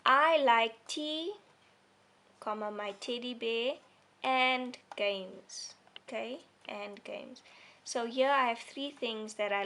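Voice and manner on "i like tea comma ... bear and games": slow and loud, pausing after "tea" and after "bear"; list with pauses. Shown as a demonstration. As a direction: read each item out to the class, one by one. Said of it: "this is for lists".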